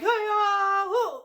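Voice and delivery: high, loud karate battle cry; karate yell. Retold or imitated. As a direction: shout it like a karate kick. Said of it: "her signature saying".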